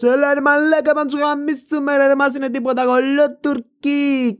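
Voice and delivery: high, rowdy football hooligan. Acted. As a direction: rile up the crowd like a hooligan. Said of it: "οπαδικούς". (φωνή χούλιγκαν)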